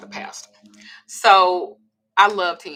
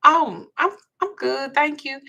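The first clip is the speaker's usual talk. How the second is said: polite decline; 9 semitones higher, 5 dB louder, slower than usual. Retold or imitated. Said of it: "I was like".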